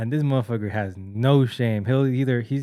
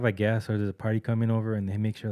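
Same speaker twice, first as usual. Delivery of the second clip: usual speaking voice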